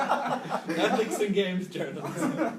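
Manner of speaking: slow laughing mockery. Real, not acted. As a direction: drawl it out while laughing. Mocking buzzword, laughing.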